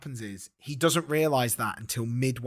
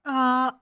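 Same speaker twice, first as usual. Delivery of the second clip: high, loud exclamation; mock sting; 13 semitones higher, 7 dB louder than usual. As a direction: hit it like a freeze-frame sting. Freeze-frame sting.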